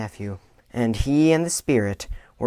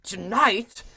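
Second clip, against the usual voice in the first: startled cry; 9 semitones higher than usual. Acted. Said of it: "cried Scrooge".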